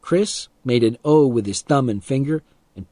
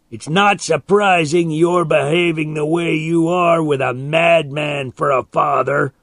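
higher, loud mocking jeer; goading taunt; 5 semitones higher, 4 dB louder than usual. A narrator's reading. Man mocks boy's mad father, goading. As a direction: rub it in with a raised voice.

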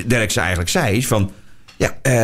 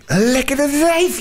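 lusty drawl; much slower than usual. Retold or imitated. Lusting over woman on TV.

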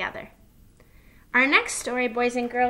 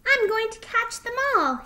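high, eager little fox; excited child's cry, pitched way up; little one determined to get every one; mock panting eagerness; 12 semitones higher, 7 dB louder than usual. A narrator's reading. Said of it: "he panted".

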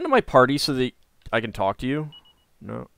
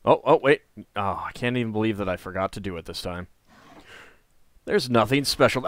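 usual speaking voice

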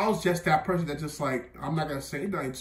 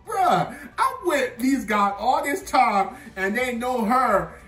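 much higher, laughing and slow; laughing disbelief; 6 semitones higher, 4 dB louder, slower than usual. Real, not acted. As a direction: laugh through it, still amazed.

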